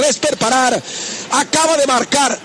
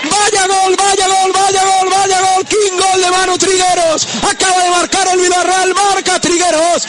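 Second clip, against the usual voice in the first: excited radio goal call, raised voice; breakneck goal commentary; 5 semitones higher, slower than usual. Acted.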